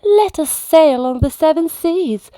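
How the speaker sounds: high, whiny plea to keep playing; pleading cubs. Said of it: "The bears cried".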